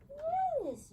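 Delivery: very high marker yes; high yes to the dog; squeaky yes. Real, not acted.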